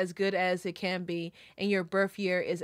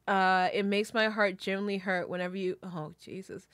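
normal talking voice

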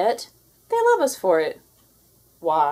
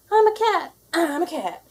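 higher and louder, thrilled; a new creature delighted with itself; bursting with glee, then settling; excited cat; 8 semitones higher, 4 dB louder than usual. Acted. First cat appears, quoted exclamation.

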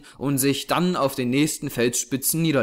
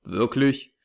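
ordinary delivery, nothing marked

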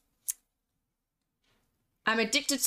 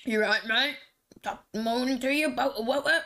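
put-on British voice, slightly raised; mock British accent; 2 semitones higher than usual. Streamer mocking their former British voice, hammed-up British accent. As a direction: imitate your old British voice. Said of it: "When I was British, my voice was like".

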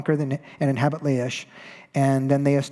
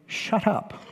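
laughing mock dismissal; 6 semitones higher, 3 dB quieter than usual. Danites silencing the protesting priest.

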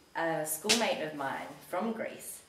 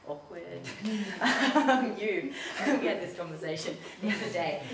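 laughing; 2 semitones higher, 6 dB louder than usual. Real, not acted. After saying she isn't a communist, real laughing. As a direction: laugh it off, embarrassed.

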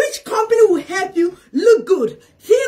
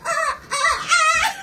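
very high joyful scream-laugh; thrilled high scream; much slower than usual. Real, not acted. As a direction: let out a rising delighted shriek. Screaming with excitement.

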